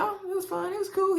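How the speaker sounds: high-pitched, breezy imitation of him; unbothered retelling. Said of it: "He wasn't bothered".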